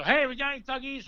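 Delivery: high, bright put-on casting agent call; casting guy. Casting man at the window calling for extras, high put-on voice. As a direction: sing out the question like a brisk casting clerk. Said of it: "they go".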